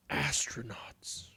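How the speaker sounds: breathless whispered wonder; hushed awe; awed whisper. A narrator's reading. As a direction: whisper it in amazement. Awed at seeing the TV.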